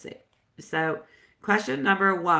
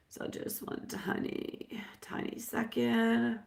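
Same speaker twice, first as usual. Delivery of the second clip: slow hushed whisper; 4 semitones higher, 10 dB quieter, slower than usual. Real, not acted. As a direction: whisper it slowly, almost no voice. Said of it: "my sexy voice".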